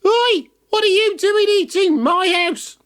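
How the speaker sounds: high, far witch voice shouting. Acted. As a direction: yell it like an angry witch. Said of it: "they heard a voice shouting".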